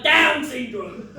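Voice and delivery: slow loud call across the park; calling the dog. Retold or imitated. Owner calling his dog back in the park, loud and slow. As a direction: shout the dog's name, stretched out. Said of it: "I'm like".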